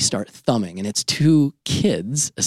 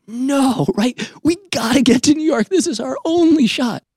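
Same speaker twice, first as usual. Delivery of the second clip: much higher, louder frantic outburst; desperate cry that they must make it; brother snapping; 4 dB louder than usual. Retold or imitated. Brother snapping on the stalled train, frantic high shout. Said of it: "my brother snaps".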